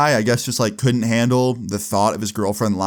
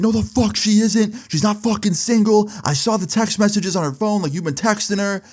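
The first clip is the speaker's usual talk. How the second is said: imitating a furious guy, much higher and strained; mock jealous outburst, pitched way up; freaking out; 11 semitones higher than usual.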